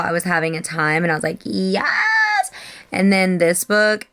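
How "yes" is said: high-pitched gleeful cheer; excited squeal. Retold or imitated. Reader recalling joy after her last book, excited squeal. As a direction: squeal it as a drawn-out celebration.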